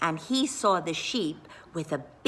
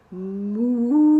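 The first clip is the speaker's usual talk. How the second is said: a big, loud comic moo; loud cow moo; 4 semitones higher, 5 dB louder than usual. A narrator's reading. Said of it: "let out a big".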